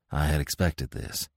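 soft, low-pitched aside; murmured. A narrator's reading. Smith confides to his friend while watching, murmuring. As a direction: keep it low and hushed. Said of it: "murmured Smith".

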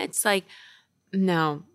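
usual speaking voice

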